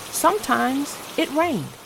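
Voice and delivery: plain reading voice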